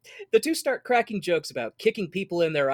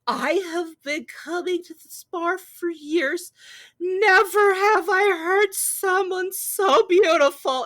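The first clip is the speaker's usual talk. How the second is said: put-on much higher, slow, swooning drunk stranger; gushing drunk woman; 13 semitones higher, 6 dB louder, slower than usual. Drunk woman at the bar gushes to the singer, slow and high. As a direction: gush it out like a tipsy fan, slow and high. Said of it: "this drunk woman comes over ... is just like".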